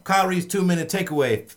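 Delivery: loud, high announcer call. Acted. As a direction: boom it out like a ringmaster. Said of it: "I feel like I'm an announcer".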